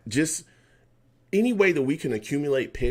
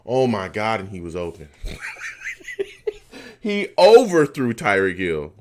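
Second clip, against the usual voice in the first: ordinary talk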